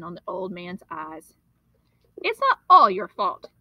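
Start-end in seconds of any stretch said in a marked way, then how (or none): 2.2-3.5 loud, high accusing outburst; boy shouting blame at the storekeeper; angry declaration. Acted. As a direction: hurl the blame at him. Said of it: "John declared".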